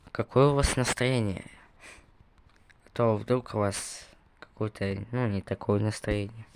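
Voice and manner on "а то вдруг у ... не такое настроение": ordinary delivery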